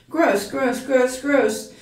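disgusted. A narrator's reading. Stuart reacting to foul water from a rag, disgusted. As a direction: spit the words out in mild revulsion.

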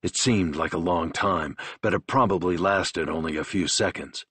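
ordinary delivery, nothing marked

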